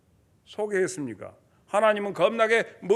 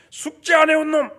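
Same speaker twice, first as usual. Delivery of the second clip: higher, louder demand for homework; strict teacher call; 6 semitones higher, 9 dB louder than usual. Retold or imitated. Preacher imitating a strict teacher in class, loud call. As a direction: call out the slackers like a stern teacher.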